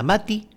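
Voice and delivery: "Mati" lifted at the end; Quechua original. Shown as a demonstration. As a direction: offer "Mati" as a question. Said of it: "mate proviene de mati".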